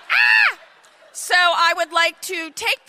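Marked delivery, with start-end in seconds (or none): 0.1-0.6 a sudden shrill scream, much higher and louder; high startled scream of dismay; shriek of horror. Retold or imitated. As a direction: shriek in panic at the bugs. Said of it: "I was like".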